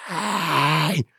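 long, high drawn-out cry; retold scream. Man overboard screaming to his boat, drawn-out high cry. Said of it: "And I just screamed".

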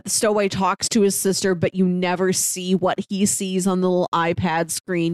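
ordinary delivery

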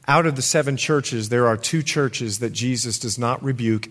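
ordinary talk

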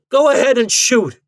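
high, loud dare; defiant cry. A narrator's reading. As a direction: cry out, daring him. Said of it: "he cried".

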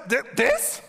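high and fast, dismissing the giant; unimpressed David. Acted. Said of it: "he walks in, he sees God".

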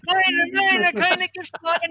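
high, loud imitated German protest; frantic hammed-up objection in German; mock panic. Traveler to German officers as all hell breaks loose, mock panic.

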